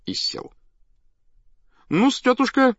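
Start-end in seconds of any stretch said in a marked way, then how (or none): 1.9-2.8 high-pitched performer's call; showman addressing his act. A narrator's reading. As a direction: cue the act in a high stage voice. Showman addresses dog before audience. (высокий голос циркового артиста)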